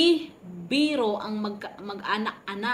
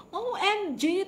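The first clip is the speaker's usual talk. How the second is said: ordinary talk, nothing marked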